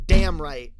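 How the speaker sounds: loud, punched DAMN; emphatic. Pretend. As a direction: belt it out with conviction. Emphatic repeat of same words.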